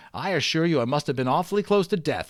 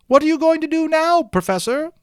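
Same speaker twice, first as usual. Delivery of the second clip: much higher, louder woman's call; yelled question; 7 dB louder than usual. A narrator's reading. Woman in audience yelling to the professor, high and loud. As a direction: shout it up to the stage. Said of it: "she yelled".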